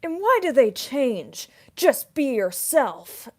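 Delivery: high, slow, loud put-on voice; hammed-up meme quote; meme character voice. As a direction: deliver it like a naive cartoon sage. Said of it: "the climate changing meme reference".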